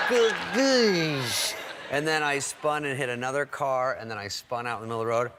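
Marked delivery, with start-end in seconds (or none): 0.1-1.5 high, loud drawn-out crash sound; imitated crash noise. Comic retelling the second impact, mock crash noise. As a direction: sing out the impact as nonsense.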